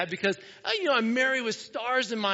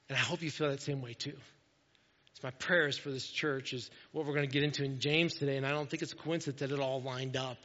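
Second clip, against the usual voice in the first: ordinary delivery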